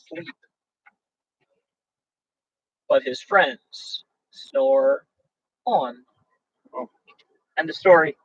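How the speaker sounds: lively, sing-song read-aloud of a rhyming book; storybook reading. A narrator's reading. Host reading a bedtime story to viewers, sing-song.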